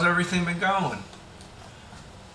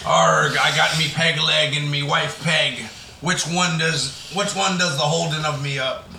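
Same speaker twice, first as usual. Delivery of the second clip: pirate voice. Acted. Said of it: "Talk Like a Pirate Day".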